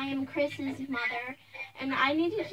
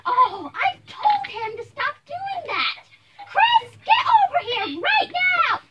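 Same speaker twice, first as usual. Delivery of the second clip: high, loud, drawn-out scolding; furious yell; 11 semitones higher, 10 dB louder, slower than usual. Acted. Missus Carson summoning her bullying son, furious yell. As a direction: build from shock to a full shout.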